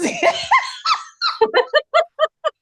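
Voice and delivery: long high laugh; high real laugh. Real, not acted. Host laughing hard with co-host.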